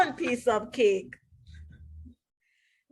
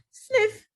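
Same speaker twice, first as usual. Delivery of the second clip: sniffing; 10 semitones higher than usual. Acted. Sniffing sound word.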